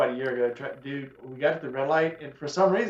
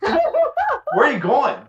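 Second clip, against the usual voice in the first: very high, very slow, louder sing-song taunt; mocking taunt; 13 semitones higher, 7 dB louder, much slower than usual. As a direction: drag the taunt out high and loud like calling a child. Taunting fleeing driver.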